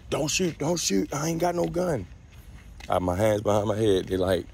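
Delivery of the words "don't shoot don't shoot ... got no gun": high, frightened pleading; begging for his life. Retold or imitated. Begging officer not to shoot.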